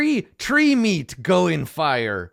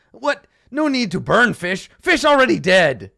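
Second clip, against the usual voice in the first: broken-English gnoll, loud and high; a blunt little gnoll scolding the cook; 7 semitones higher, 8 dB louder than usual. Acted. Gnoll scolds the dwarf for burning the fish, loud and high. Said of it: "he shakes his head and he's like".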